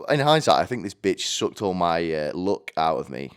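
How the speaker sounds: ordinary talk, nothing marked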